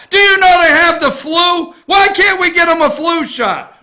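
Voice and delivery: loud, high-pitched accusing questions; indignant grilling. Acted. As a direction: hammer out outraged questions like a confronting reporter. Acting out questions reporters should shout.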